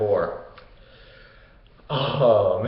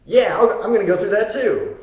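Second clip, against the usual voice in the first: mock amazement, pitch climbing and getting louder; excited Hercules; 6 semitones higher, 3 dB louder than usual. Hercules reacts to the portal to Hades, excited. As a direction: play a wowed hero jumping at the chance. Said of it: "Hercules is like".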